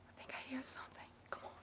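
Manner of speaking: hushed whisper, a little fast. Acted. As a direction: whisper so the animal won't hear. Creeping up on the animal.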